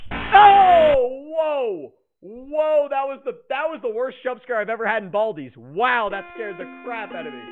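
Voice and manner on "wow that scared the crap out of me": usual speaking voice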